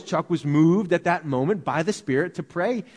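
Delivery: ordinary delivery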